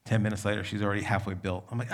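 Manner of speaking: ordinary talk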